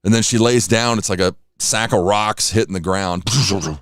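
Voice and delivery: ordinary talk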